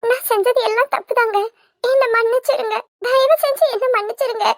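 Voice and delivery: high-pitched fake weeping apology; put-on tearful pleading. Wife faking tears to win husband over, tearful plea. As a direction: beg forgiveness with crocodile tears. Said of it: "எதுக்கு சின்ன பொண்ணு நீ இப்படி அழுதுகிட்டு இருக்க".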